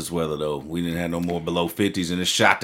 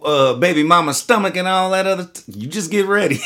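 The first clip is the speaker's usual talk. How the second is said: laughing warning; 9 semitones higher, 4 dB louder than usual. Retold or imitated. Teasing warning quote.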